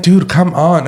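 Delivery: exasperated. Retold or imitated. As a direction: groan at the slow driver. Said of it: "and I'm like".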